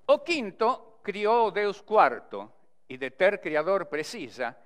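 declaimed cordel verse, strong measured opening; verse recitation. Shown as a demonstration. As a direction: recite each line with weight and a pause after it. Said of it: "recitando para vocês".

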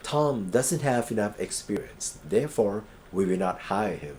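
very slow, evenly spaced sentence; slow model reading. Shown as a demonstration. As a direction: dictate it word by word. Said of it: "teacher reads example sentence". (อ่านประโยคช้าๆ ทีละคำ)

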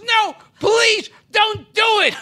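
high, mock-desperate begging; pleading cry. Caught model begging her boss, in a high desperate mock plea.

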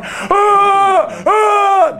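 repeated high, loud "aah" cries; long pushed-up impression howls; mock wrestler yells. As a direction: holler like everyone in a claw hold. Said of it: "We're all going".

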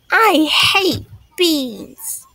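high, slow complaint of a little sister; stubborn dislike. Acted. Rosie objecting to mother's favorite dish, stubbornly disgusted. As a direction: drag it out high in protest.

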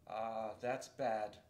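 slow, gloomy beaver voice; a touch of a grave old beaver; Mister Beaver. Acted. Mister Beaver tells the children bad news, slowly and gravely.

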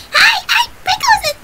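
very high, loud squeaky character voice; piercingly squeaky and loud; Sprinkles voice. Shown as a demonstration. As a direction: squeal a greeting as a cute little character. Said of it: "to do that voice".

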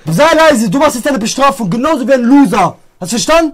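loud, commanding telling-off; loud scolding. Real, not acted. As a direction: lay down the punishment forcefully. Winner scolding loser before punishment.

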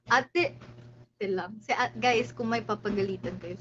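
ordinary delivery

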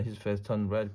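usual speaking voice

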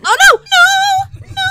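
loud, very high wail; real wailing dismay. Real, not acted. Gamer sees the round slipping away, wailing dismay. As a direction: cry out as it goes wrong. Losing a move in the game.

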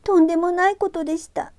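put-on high, pleading girl's voice; 必死の祈り. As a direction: beg desperately as a young girl. ユキが神仏に必死に祈る. (必死に懇願する少女の声)

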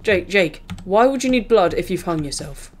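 ordinary talk

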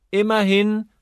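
put-on the young noblewoman chiming in sharply; Lady Mary. A narrator's reading. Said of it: "sagte Lady Mary".

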